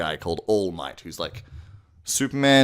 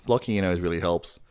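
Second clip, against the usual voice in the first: normal talking voice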